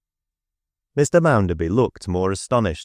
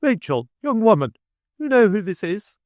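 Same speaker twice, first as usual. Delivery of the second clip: triumphant Mrs Sparsit; 13 semitones higher than usual. A narrator's reading. Mrs Sparsit calling into the coach, high and triumphant. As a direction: crow it in a much higher voice. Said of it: "cried Missus Sparsit".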